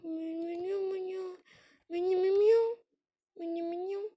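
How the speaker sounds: put-on high cooing baby talk to a horse. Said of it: "Pardon pour ma voix de bébé".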